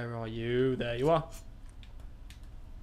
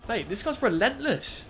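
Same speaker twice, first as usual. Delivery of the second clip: exasperated; 9 semitones higher than usual. Real, not acted. Frustrated at relentless enemy.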